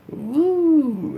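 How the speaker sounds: a high, sarcastic whoop; mock cheer. Vlogger mocking a Trump fan with a fake high cheer.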